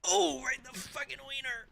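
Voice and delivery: high-pitched disbelieving outburst; shocked wince. Real, not acted. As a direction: react to a painful hit, voice leaping high. Reacting to painful hit in game.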